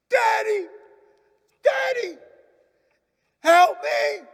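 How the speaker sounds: put-on high, loud, desperate child's cry; frightened boy shouting from the window; child crying for help. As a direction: scream for daddy from the burning room. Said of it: "cried out the window".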